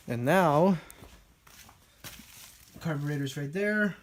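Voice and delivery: usual speaking voice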